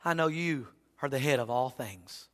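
soft, hushed prayer to the Lord; hushed sample prayer; quiet prayer. Shown as a demonstration. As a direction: pray it gently, calming yourself down.

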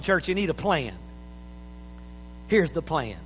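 normal talking voice

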